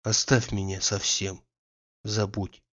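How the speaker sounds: soft, hushed imploring plea; tender pleading. A narrator's reading. Oblomov pleads softly with his friend Andrei, tender hush. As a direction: beg him gently to let go. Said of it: "нежным, умоляющим голосом".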